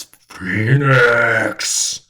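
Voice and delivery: loud, long-drawn roar of a name; monster roar. Acted. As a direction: bellow the name like a beast bursting in. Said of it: "roars and bursts through and says".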